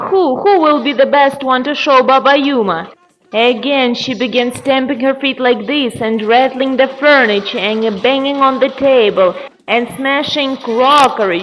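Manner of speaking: ordinary delivery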